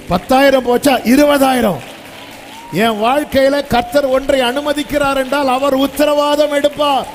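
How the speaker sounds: rising, loud preaching call; fiery preaching. Acted. Pastor stirring the congregation to clap, loud preaching. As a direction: preach it hard, building to the amen.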